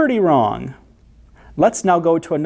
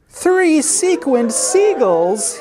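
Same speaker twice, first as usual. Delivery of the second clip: high, drawn-out cry of dismay; high-pitched stretched-out exclamation; 10 semitones higher, much slower than usual. A narrator's reading. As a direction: wail each word slowly in disbelief. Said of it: "Marigold howled".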